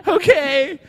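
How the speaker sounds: high, laughing disbelief; laughing okay. Real, not acted. Laughing okay while riding the baggage cart.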